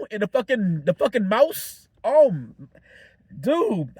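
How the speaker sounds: ordinary delivery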